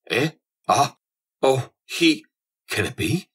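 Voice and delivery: short, high-pitched gasps of recognition; startled exclamation. A narrator's reading. Captain peering at the stranger who bumped him, startled exclamation. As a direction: exclaim in dawning surprise, word by word. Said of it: "he exclaimed".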